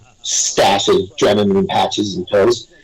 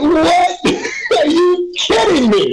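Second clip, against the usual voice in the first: much higher, laughing disbelief; shocked disbelief; slower than usual. Retold or imitated. Retelling the leader's invitation, high laughing disbelief. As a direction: squeak it out, laughing, unable to believe it.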